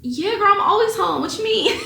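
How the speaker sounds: high, bright mock excited reply; my own excited voice. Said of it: "And I'm like".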